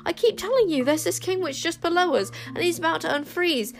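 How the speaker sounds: put-on high, urgent girlish pleading; distressed. A narrator's reading. Girl begging both sides to stop fighting, distressed. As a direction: plead desperately to be listened to. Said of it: "cried Wish in distress".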